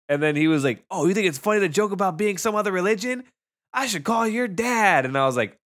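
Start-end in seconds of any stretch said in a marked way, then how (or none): 0.9-5.1 high whiny mock teacher; squeaky imitation of the teacher. Mocking teacher's voice.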